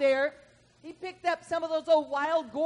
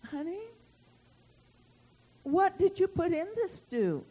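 higher, hesitant husband's question; wary questioning; 5 semitones higher than usual. Retold or imitated. Husband asks wife about her stew, warily questioning. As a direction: lightly imitate a suspicious husband, pitched up. Voicing puzzled husband at dinner.

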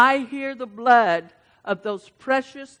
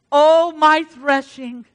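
slow scripture reading; 4 semitones higher, 6 dB louder, slower than usual. Acted.

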